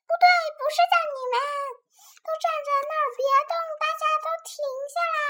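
very high, frantic calling; high-pitched urgent cry; loud shout. Acted. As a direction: yell to halt a whole crowd. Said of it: "莉莉大声喊道".

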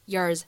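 low, weakened "yours", like "yers"; weak form of YOURS. Shown as a demonstration. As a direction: say "yours" unstressed with a short, reduced vowel. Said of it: "我们可以弱读".

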